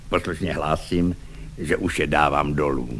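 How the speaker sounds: low, soft obedient report; meek compliance. A narrator's reading. Švejk reports obedience to the lieutenant, meek and soft. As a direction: answer quietly, dutifully. Švejk meekly obeys shouted order.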